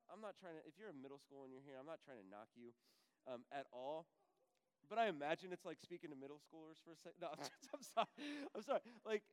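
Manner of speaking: ordinary talk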